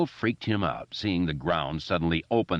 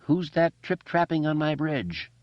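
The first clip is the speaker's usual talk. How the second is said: higher, hollow intoning troll; 9 semitones higher than usual. A narrator's reading. Eddie from below playing the troll, hollow intoning. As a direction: intone it like the troll under the bridge. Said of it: "who intoned hollowly".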